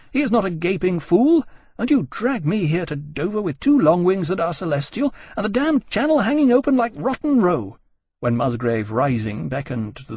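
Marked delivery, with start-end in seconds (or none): 0.1-7.8 high, loud, scathing tirade; shouting. Acted. Jane shouting about the undefended Channel, outraged. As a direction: build the outrage to the last words. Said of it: "Jane was shouting".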